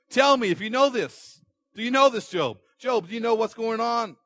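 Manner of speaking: God demanding an answer from Job; thundering God. Acted.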